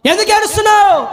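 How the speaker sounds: high, sustained ringing question; Jesus calling out. Acted. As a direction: intone the question high, acting the divine questioner. Acts Jesus asking why weeping. (ఎలుగెత్తి సాగదీసిన ప్రశ్న)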